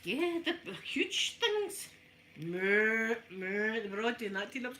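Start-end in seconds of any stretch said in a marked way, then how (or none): 2.4-3.8 imitated mooing, lightly voiced; cow moo. Showing viewers how the cows sounded, a light moo. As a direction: moo like the cows round the car.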